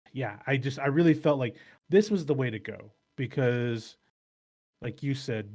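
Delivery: ordinary talk, nothing marked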